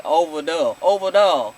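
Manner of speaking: low attempt at a country accent; trying out a country "over there"; imitated country twang, not quite getting it; [name]. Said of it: "I can't even say it".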